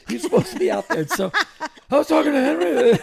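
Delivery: high, loud mock scolding wife. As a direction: play the annoyed manager calling him out. [name] scolding [name], retold.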